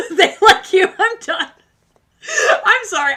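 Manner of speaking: loud, high, breaking into laughter; can't get the words out for laughing; laughing helplessly. Real, not acted. Reader to viewer, laughing loud and high.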